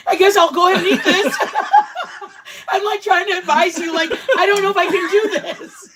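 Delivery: talking through big laughs; laughing while quoting. Real, not acted. Laughing while retelling his reaction.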